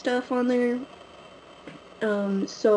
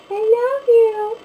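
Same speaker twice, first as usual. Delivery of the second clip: slow sing-song pet talk, pitched way up; stretched cooing to the cat; 14 semitones higher, 6 dB louder, much slower than usual. Real, not acted. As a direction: stretch each word sweetly up high for the cat. Talking sweetly to her cat.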